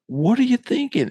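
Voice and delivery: high-pitched, incredulous demand, retold; mock outrage without shouting; livid coach. Coach livid at the player after losing, high-pitched exasperation. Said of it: "I'm livid, and I'm".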